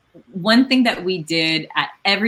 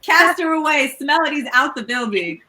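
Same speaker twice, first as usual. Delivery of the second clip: hyped-up, high-pitched delight; excited cheering; 11 semitones higher than usual. Real, not acted. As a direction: gush over her like a proud cheerleader. Host cheers Melody's share.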